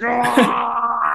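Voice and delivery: sharp high cry of effort, as if lifting something too heavy; a quick pained "Ah!" of someone who can't lift the weight; straining grunt. Retold or imitated. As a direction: let out a strained yelp mid-lift. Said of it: "I was like".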